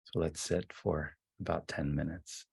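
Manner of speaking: soft, unhurried voice with long silences; guided meditation. Real, not acted. Leader settling the group into ten minutes of sitting, guided meditation. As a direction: ease them gently into stillness, phrase by phrase.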